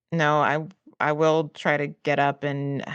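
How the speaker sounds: normal talking voice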